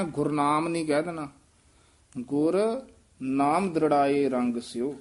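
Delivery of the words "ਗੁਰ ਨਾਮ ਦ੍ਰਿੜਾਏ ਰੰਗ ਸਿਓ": ਗੁਰ said apart, a pause before ਨਾਮ; separate the words. Shown as a demonstration. Teacher shows the student to split ਗੁਰ from ਨਾਮ, words kept apart. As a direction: say ਗੁਰ, stop, then ਨਾਮ as its own word. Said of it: "ਨਖੇਰ ਕੇ ਪੜ੍ਹਨਾ".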